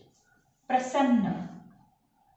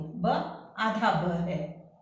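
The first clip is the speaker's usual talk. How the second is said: ब आधा ब है said very slowly; slow explanation; 3 semitones lower, much slower than usual. Shown as a demonstration. Teacher slowly explains the half letter to pupils. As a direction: spell out the half ब patiently. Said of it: "यहाँ पे देखो".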